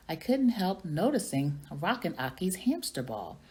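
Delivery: plain reading voice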